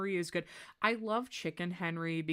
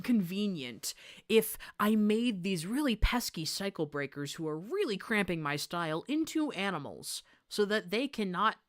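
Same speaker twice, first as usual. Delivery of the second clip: lightly voiced smug villain, higher and louder; villain Wilson scheming; 4 semitones higher, 6 dB louder than usual. Streamer explaining the AU, voicing Wilson's plan as a light smug villain. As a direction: play the gloating schemer, a little hammed. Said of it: "Wilson decides".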